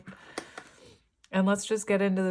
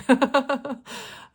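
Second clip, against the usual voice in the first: loud burst of laughter; 8 semitones higher, 6 dB louder than usual. Real, not acted. Laughing at a channeled message.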